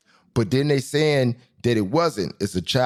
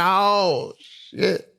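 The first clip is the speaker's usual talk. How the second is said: sharp "Oh" then a breathless "shit"; stunned exclamation; 8 semitones higher than usual. Retold or imitated. Seeing Jessica Alba on screen, stunned exclamation. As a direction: gasp it out starstruck. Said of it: "I said".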